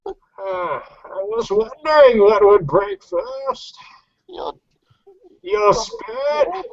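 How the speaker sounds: slow, muffled villain voice, rising on spirit; Bane impression. Taunting a beaten foe, as a muffled masked Bane. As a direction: speak through a mask, slow and menacing. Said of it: "you gotta muffle your voice".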